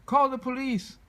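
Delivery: high loud panicked shout. A narrator's reading. Frightened townsperson calling for police, loud panicked shout. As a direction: yell for help.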